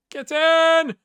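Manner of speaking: high, loud cheer; celebratory shout. Real, not acted. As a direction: yell it as the goal goes in. Celebrating a goal in game.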